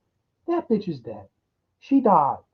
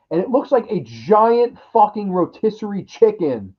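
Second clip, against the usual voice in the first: ordinary delivery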